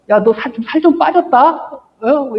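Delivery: lightly voiced dog teasing, pitch rising on 빠졌다; mock surprise from a dog; a hint of a teasing dog. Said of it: "이러고 이제 얘기를 해요".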